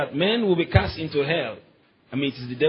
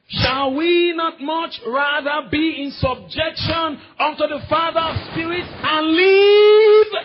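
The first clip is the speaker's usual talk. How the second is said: slow, much higher and louder sermon cry; preaching at full force; 12 semitones higher, 6 dB louder, slower than usual. Acted. Preacher crying the verse to the church, slow and much higher. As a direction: draw out the verse like a rising call.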